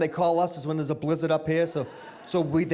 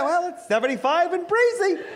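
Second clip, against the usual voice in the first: high, sing-song taunting reply; smug gloat; 10 semitones higher than usual. Retold or imitated. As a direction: lay it on with a gloating lilt. Smug reply mimicking friend.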